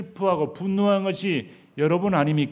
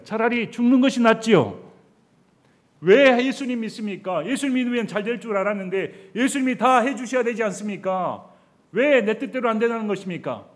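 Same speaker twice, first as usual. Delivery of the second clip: high loud aggrieved protest; loud bitter complaint; 8 semitones higher, 9 dB louder than usual. Retold or imitated. Preacher voicing believers complaining to God, loud and high. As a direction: hurl the complaint upward.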